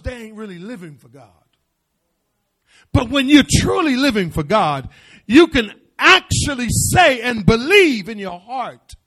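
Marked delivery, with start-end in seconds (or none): 2.9-9.0 loud, rising preacher's cry; full-force preaching. Acted. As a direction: preach it out to the congregation. Preacher building up.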